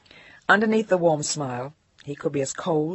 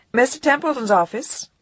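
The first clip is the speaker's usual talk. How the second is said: higher, louder poised secretary coo; 8 semitones higher, 5 dB louder than usual. Acted. Secretary cooing into the phone. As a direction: purr it with cool authority. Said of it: "cooed by a mildly intimidating, highly poised youngish voice".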